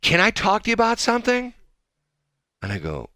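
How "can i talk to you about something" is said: high-pitched, earnest request in imitation; lady impression. Host voicing the lady approaching him, high imitated voice. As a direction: mimic a friendly stranger broaching a subject. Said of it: "And she goes".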